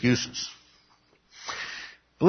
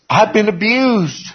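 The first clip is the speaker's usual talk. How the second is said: high, drawn-out victim plea; milk the excuse in a high, louder voice; hammed-up self-pity; mock complaining; 10 semitones higher, 5 dB louder, slower than usual. Acting Joseph's victim excuse.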